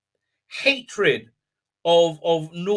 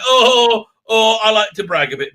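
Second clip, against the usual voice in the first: mock bragging; 4 semitones higher, 7 dB louder than usual. Mocking the character's bragging.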